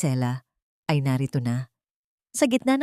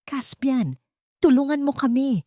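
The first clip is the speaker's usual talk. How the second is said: higher, frightened cry for help; shout for help; 8 semitones higher than usual. A narrator's reading. Zendaya running to Caspian begging for help, shouting. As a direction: call out running, scared. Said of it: "sigaw ni Zendaya".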